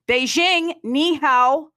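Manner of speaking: loud, high-pitched city greeting; calling out. Pretend. Host greeting listeners in Beijing, calling out loudly. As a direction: hail a faraway city at full voice.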